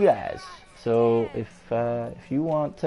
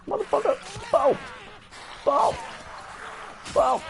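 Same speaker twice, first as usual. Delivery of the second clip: high battle yell. Acted. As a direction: shout it like charging in.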